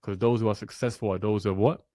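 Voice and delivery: ordinary talk, nothing marked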